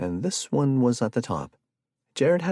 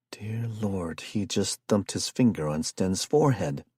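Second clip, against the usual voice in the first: usual speaking voice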